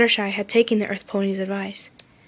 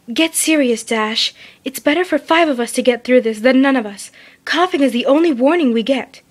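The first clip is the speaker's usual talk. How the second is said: stern Twilight voice; 4 semitones higher, 5 dB louder than usual. A narrator's reading. Twilight urges hard choice.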